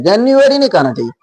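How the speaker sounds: ordinary talk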